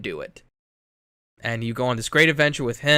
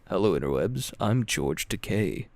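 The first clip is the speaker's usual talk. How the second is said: George Takei impression; 5 semitones lower than usual. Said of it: "I thought you were gonna be like".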